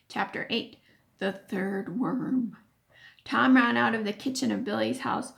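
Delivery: plain reading voice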